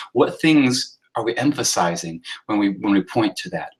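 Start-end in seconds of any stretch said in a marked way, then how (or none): none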